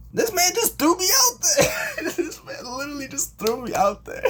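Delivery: high, loud comic disbelief; mock outraged yell. Caller telling family about the surprise call, laughing high yell. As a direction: holler it high with laughs breaking in.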